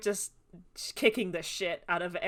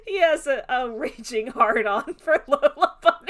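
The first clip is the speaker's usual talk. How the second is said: loud, laughing outburst; cracking up at own crassness; laughing confession; 5 semitones higher, 9 dB louder than usual. Real, not acted. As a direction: blurt it out loud through laughter. Laughing while saying the crass thing.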